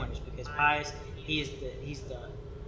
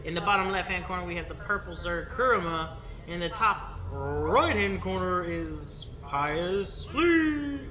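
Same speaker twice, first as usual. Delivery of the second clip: booming ring announcer intro, louder and higher; 4 semitones higher, 7 dB louder, slower than usual. Pretend. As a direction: call out the fighters like a fight-night MC. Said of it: "That was my announcer voice".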